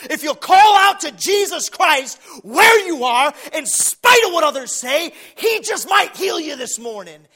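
high, shouted sermon crescendo; preaching at full force. Real, not acted. Preacher urging congregation to call out to Jesus, impassioned shout. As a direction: drive each promise home at full volume.

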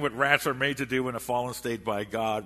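ordinary delivery

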